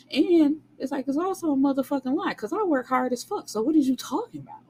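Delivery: high, fast exasperated rant. Retold or imitated. Heroine calling her boyfriend a liar, high fast rant.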